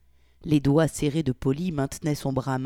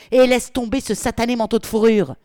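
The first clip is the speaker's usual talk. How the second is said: loud angry order; 7 semitones higher, 9 dB louder than usual. Acted. Polly forcing Victoria out.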